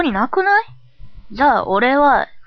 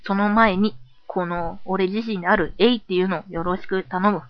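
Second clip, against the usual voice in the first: put-on subdued, understated boyish voice; cool boyish persona; 4 dB quieter than usual. Cool persona soberly asking listeners for support, subdued.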